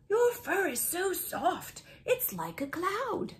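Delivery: gentle friendly dog. Acted. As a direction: coo it like stroking a cloud. Friendly dog compliments fur.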